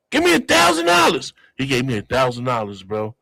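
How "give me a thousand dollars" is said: high, louder eager demand; acted demand. Passenger at the open safe, high acted demand. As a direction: pitch it way up as a demand. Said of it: "I was like".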